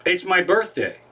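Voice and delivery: normal talking voice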